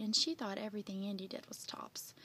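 ordinary delivery

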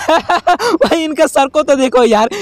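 real laugh breaking into the words. Real, not acted. Gamer laughing at the characters' dizzy heads, laughing. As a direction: talk through a burst of giggles. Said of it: "मुझे हंसी आ रही है".

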